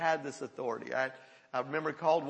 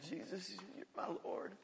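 imitated crying; 12 dB quieter than usual. Freed young man confessing Jesus, crying. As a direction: barely get it out, as if through tears. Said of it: "He just cried and kept crying".

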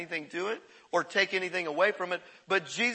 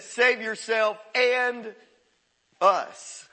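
slow mocking plea; 3 semitones higher, 4 dB louder, much slower than usual. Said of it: "then he said".

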